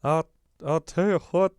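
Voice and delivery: mock a southern accent, cranked up. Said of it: "one of the worst southern accents I've ever heard".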